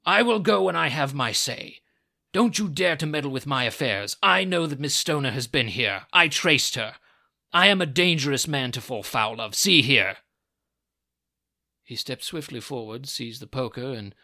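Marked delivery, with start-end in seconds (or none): 0.1-10.2 very loud, forceful warning; blustering, furious old man; angry threat. A narrator's reading. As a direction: threaten them at the top of your temper. Furious visitor threatens Holmes.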